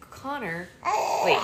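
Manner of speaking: ordinary delivery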